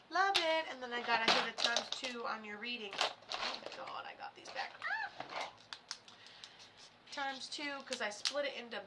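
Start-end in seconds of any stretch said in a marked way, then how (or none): 0.1-0.7 higher, louder exclamation; excited. Real, not acted. Repeated, then bright. 3.0-4.7 hushed whispered aside. Real, not acted. Whispered aside while working.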